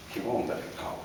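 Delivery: usual speaking voice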